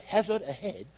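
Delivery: soft, half-hearted British girl imitation; little British girl. Preacher mimicking his Waze voice, half-hearted British girl. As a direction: attempt a small British voice, softly. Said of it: "mine's a little British girl".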